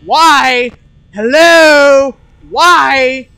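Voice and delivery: real frustrated yelling, building to a shout; a player losing it, shouting at the screen; escalating yell. Real, not acted. Game jumpscare frustration, repeated why.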